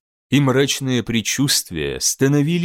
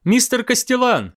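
higher call across the bridge; 10 semitones higher than usual. Acted. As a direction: call him sharply. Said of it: "крикнула Каминска".

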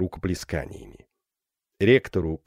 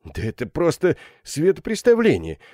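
higher, exasperated protest; indignant; 7 semitones higher than usual. A narrator's reading. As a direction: protest at the din, scandalised. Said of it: "возмутился мэтр Андри". (возмущённо)

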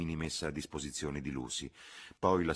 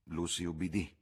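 ordinary delivery

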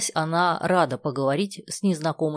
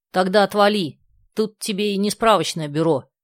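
louder, irritated brush-off; angry snap; 4 semitones higher, 6 dB louder than usual. A narrator's reading. Girl at the kiosk window snaps angrily at the kids. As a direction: tell him to get lost, annoyed. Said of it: "рассердилась девица".

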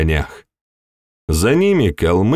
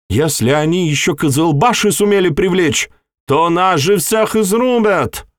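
frantic retreat order; 9 semitones higher than usual. A narrator's reading. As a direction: scream for everyone to run now. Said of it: "но генерал вновь выкрикнул".

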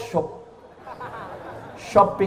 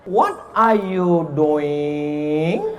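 slow English demo; much slower than usual. Shown as a demonstration. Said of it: "nggak ada beda".

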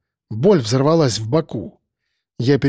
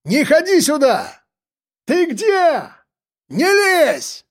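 barking shout; 13 semitones higher, slower than usual. A narrator's reading. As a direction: bark it out sharply. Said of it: "гаркнул я".

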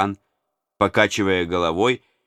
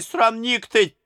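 put-on old nurse repeating her insult at the tsar; angry retort; slower than usual. A narrator's reading. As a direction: toss off "срамник ты!" high and quick. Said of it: "закричала она на Иоанна".

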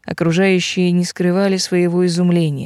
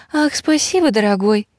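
bashful wife; 3 semitones higher than usual. A narrator's reading. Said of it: "она покраснела".

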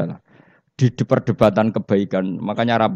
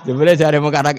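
ordinary talk

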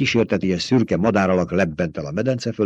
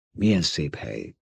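softly hushed, lower murmur; lightly whispered, pitched down; whispering; 4 semitones lower, 7 dB quieter than usual. A narrator's reading. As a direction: speak low and softly in awe.

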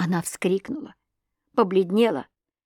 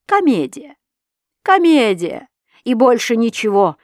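agitated; 3 semitones higher, 5 dB louder than usual. A narrator's reading. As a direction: throw the word out in agitation. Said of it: "в невообразимом волнении".

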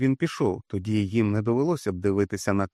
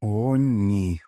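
hurt weak; 3 dB louder, much slower than usual. A narrator's reading. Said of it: "скривдженим кволим голосом".

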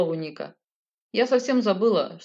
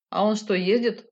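ordinary delivery

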